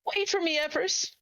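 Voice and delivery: put-on very high child's voice crying after a sibling; a small child left behind, calling out; child calling after Everest, very high and desperate. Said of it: "you hear the words".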